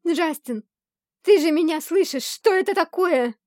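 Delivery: high, loud panicked shout; frightened cry. A narrator's reading. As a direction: scream it out in terror. Heroine cries out in terror. (испуганный крик)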